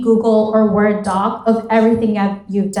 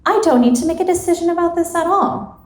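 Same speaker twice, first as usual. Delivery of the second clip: higher, quick dismissal of the whole decision; high-pitched self-talk; 7 semitones higher, faster than usual. Acted. Acted self-talk, second of two versions.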